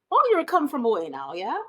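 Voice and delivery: a rusty, hammed-up Newfoundland local greeting; Newfoundland accent. Greeting a visitor to Gander in a mock Newfoundland accent. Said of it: "it's a terrible accent".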